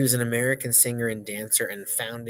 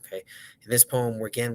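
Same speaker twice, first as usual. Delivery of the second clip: plain reading voice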